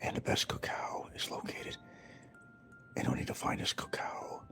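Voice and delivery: hushed, secretive ad-voice whisper; mock-sultry ad whisper; imitating ad whisper. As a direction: whisper it like a luxury cocoa ad. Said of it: "and he's whispering".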